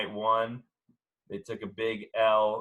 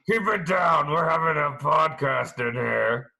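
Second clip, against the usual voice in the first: high, loud gruff coach voice; Ed Orgeron impression; 6 semitones higher, 7 dB louder than usual. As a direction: bark it like a football coach. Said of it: "that's what Ed Orgeron says to his players".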